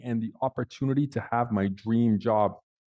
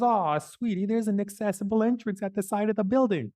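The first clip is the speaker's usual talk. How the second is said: much higher, sugary cooing Aw; pitying receptionist; 10 semitones higher than usual. Retold or imitated. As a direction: coo at him like a small child. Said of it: "She goes".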